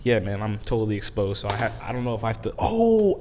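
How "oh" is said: surprised. Real, not acted. Phone just dropped.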